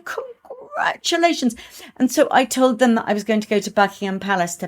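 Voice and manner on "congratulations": high, slow, gushing congratulations; a lightly voiced thrilled pupil. Pupils congratulating their teacher, high and gushing. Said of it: "they were going".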